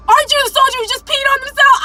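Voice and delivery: high, fast imitation of a sergeant shouting; drill sergeant shout. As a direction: call someone out before everybody. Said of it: "drill sergeant had noticed and was just like".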